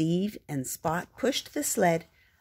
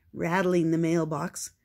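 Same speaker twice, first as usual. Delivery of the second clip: plain reading voice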